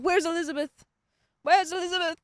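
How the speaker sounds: high, loud professor calling out; imitated professor. Student mimicking professor searching the lab for her, calling out. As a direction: call across the room in a mimicked accent. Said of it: "He's like".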